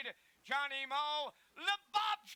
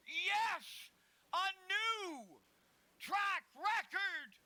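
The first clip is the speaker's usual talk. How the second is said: excited; 4 dB louder, much slower than usual. Acted. Record finish in race call.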